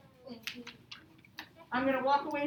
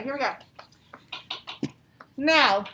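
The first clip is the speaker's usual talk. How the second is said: normal talking voice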